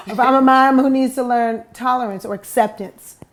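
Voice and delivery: ordinary talk, nothing marked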